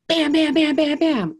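loud, high pounding bam bam; mimicking door pounding; loud knocking. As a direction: bang out each bam like fists on a door.

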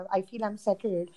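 normal talking voice

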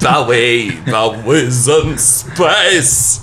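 stretched-out spacey parody of a rock star. Acted. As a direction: drag each word out like a spaceman impression. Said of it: "Flight of the Conchords impression".

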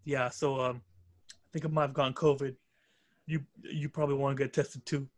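mock-solemn like announcing a death; mad serious. Mimicking Malachi's phone call about covid, mock-grave and low. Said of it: "mad serious".

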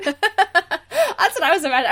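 normal talking voice

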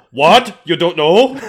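loud, high, badly faked Austrian accent; Arnold Schwarzenegger. Shown as a demonstration. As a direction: attempt an Austrian action-hero accent, amused. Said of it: "I can't do an Arnold accent very well".

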